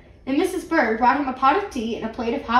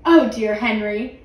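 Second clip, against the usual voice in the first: plain reading voice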